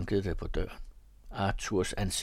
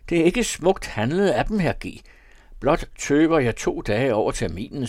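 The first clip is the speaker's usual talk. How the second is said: louder, higher, mock-grand young gentleman; theatrical young lieutenant fobbing off the tailor, louder and higher; playful young debtor; 5 semitones higher, 7 dB louder than usual. A narrator's reading. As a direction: play the charming rogue lecturing his creditor. Arthur's long speech to the tailor.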